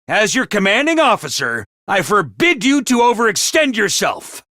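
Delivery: ordinary talk, nothing marked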